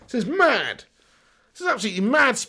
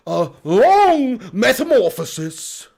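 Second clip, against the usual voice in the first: very slow, drawn-out documentary narrator; high, loud, hammed-up nature documentary voice; Attenborough; 5 semitones higher, 6 dB louder, slower than usual. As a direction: narrate wildlife with grand, stretched words. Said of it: "Attenborough or Clarkson?".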